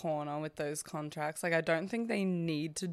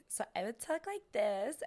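put-on valley girl voice; 4 semitones higher than usual. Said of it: "I was Britney".

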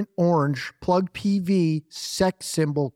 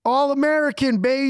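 much higher, louder proud cry; proud boast; 10 semitones higher, 4 dB louder than usual. Real, not acted. Defending his truck as American, high proud shout. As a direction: shout it out like a patriot.

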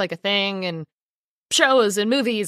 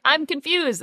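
higher, puzzled put-on voice; voicing the clueless brother in a joke scene, pitched up and confused; mock confused brother; 8 semitones higher, slower than usual. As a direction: play the baffled brother, pitched up.

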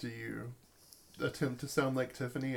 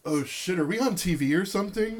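higher, louder flustered outburst; alarmed, raised voice; startled man; 6 semitones higher, 7 dB louder than usual. Acted. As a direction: panic at being caught on camera. [name] startled by unexpected visitors.

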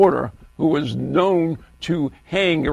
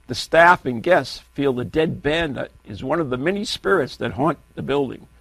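ordinary talk, nothing marked

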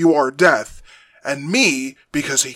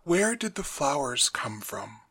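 quiet, reasonable question; normal, reasonable tone; 11 dB quieter than usual. Acted. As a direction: ask softly, without anger. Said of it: "in a normal, reasonable tone of voice".

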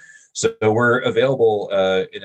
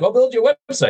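mock eager offer; 3 dB louder, faster than usual. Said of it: "somebody was like".

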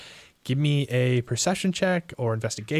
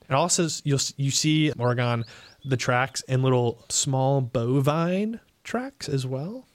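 usual speaking voice

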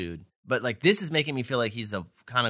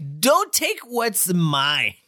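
put-on loud, high, drawn-out drunken demand; slow, overdone drunk protest; drunk Rory; 7 semitones higher, 5 dB louder, much slower than usual. Imitating a drunk character from the show, hammed-up tipsy outburst. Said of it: "I like drunk Rory here".

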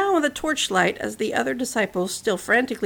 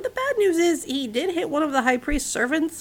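ordinary delivery

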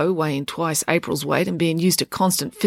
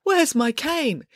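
much higher, slow and helpless; 6 semitones higher, slower than usual. Acted. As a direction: play the lost victim. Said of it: "in a helpless voice".

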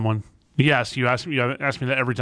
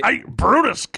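higher, louder mock Popeye call; angry Popeye; 11 semitones higher, 5 dB louder, slower than usual. Showing Popeye getting mad at Brutus, higher and louder. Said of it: "he'd get mad".

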